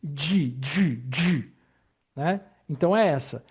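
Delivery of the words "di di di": retroflex di, tongue curled back; model syllable di. Shown as a demonstration. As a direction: demonstrate the curled di slowly. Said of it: "ela soa bem semelhante com a inicial Z H". (sílaba di com a língua curvada)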